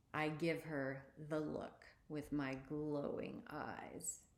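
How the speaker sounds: ordinary delivery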